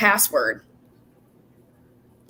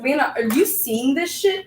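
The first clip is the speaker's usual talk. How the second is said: retold alarmed friend, pitched up high; excited quote; 6 semitones higher, 3 dB quieter than usual. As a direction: tell it like your friend pointing at something shocking. Jamie quoted in excited retelling.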